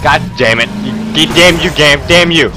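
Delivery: loud high angry cursing; furious cursing. Real, not acted. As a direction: curse the game in fury. Cursing the game loudly.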